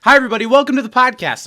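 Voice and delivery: loud, bright show opening; big announcer welcome; podcast host intro. Acted.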